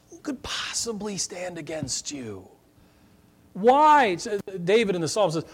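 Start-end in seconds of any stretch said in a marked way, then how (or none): 0.1-2.5 whispered question. Real, not acted. Pastor to congregation, sudden whispered question.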